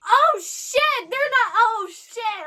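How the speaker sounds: loud, high yelp of alarm. Real, not acted. Viewer watching the danger close in, alarmed. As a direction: cry out as danger appears.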